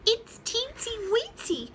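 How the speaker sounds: high, cute hen voice; clucking smaller chicken. Acted. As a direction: say it tiny and cute. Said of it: "clucked the smaller chicken".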